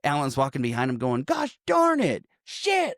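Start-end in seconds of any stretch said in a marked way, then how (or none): 1.2-2.2 high, slow imitation of an outburst; mock tame cursing. Podcaster imitating Alan copying Phil from behind, high mock cursing. 2.4-2.9 high-pitched acted expletive; mock angry cursing. Podcaster acting out Phil's freak-out, high mock cursing.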